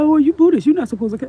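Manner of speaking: high, loud sneering mimic of critics; mock the preachy people who judge her; mocking nagging people. Mimicking critics who scold a Buddhist, high and loud. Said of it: "people are".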